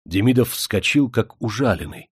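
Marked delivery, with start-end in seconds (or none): none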